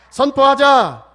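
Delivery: rally the room, ringing out 선포하자; shouted declaration. Shown as a demonstration. Pastor rousing the congregation to repeat, 선포하자 shouted at full force. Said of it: "따라서 합시다".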